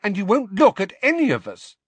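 high, loud accusation; indignant accusation. A narrator's reading. Ginny reproaching Harry for avoiding everyone, indignantly. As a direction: accuse him, pitch and volume up.

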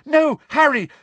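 high, loud desperate urging; cracked shout. A narrator's reading. Hermione urging Harry to confess, cracked shout. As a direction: cry out urgently, voice cracking. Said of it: "shouted Hermione in a cracked voice".

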